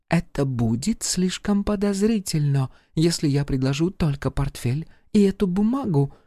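high-pitched French lady voice; femme fatale. Acted. Charlotte's voice again.

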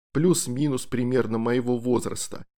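ordinary delivery, nothing marked

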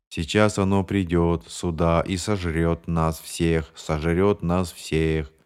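slow, lifeless monotone; eerie monotone. Acted. James predicts it will eat them all, slow dead monotone. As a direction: drone it slowly, no life in it. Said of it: "страшной монотонностью".

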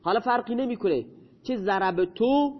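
ordinary delivery

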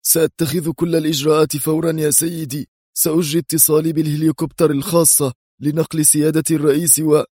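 high, resolute soldier's reply; firm military tone. A narrator's reading. Security chief promises action to his superior, firm and military. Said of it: "بلهجة عسكرية حازمة".

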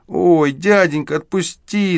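put-on the caught boy begs Saenko to let him go; shrill pitiful squeal. A narrator's reading. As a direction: wail it like a caught kid begging to be let go. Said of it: "заверещал ... тоненьким жалобным голоском".

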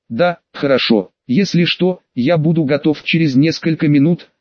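ordinary delivery

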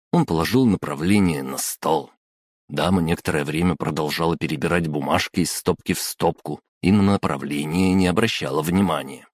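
usual speaking voice

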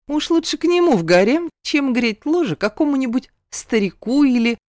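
hint at it mischievously; sly hinting. A narrator's reading. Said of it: "намекнула". (с лукавым намёком)

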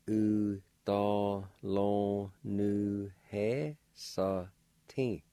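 "U, do, lo, nu, he, sa, tin" in separate syllables; one syllable at a time, "U, do, lo, nu, he, sa, tin"; slow pronunciation. Shown as a demonstration. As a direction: sound out "U, do, lo, nu, he, sa, tin" evenly. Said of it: "Pronounced like this".

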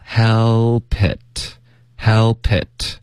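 "help it" run together as hel-pit, slowly; slow linked "help it"; linked sounds. Shown as a demonstration. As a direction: slide the P of "help" onto "it". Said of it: "会连读".

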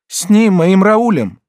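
high, slow, loud desperate cry. A narrator's reading. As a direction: cry out for her lover in terror. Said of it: "вскричала она".

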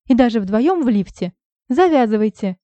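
loud, high furious rant; angry shout. Acted. Heroine shouts at both men, fed up. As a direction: throw it at them, fed up.

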